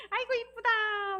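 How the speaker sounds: very high, loud, drawn-out cooing; self-praise. Shown as a demonstration. Counselor modelling self-praise for the group, gushing coo. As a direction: gush over yourself in a playful sing-song. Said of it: "셀프 칭찬 한번 해볼까요?".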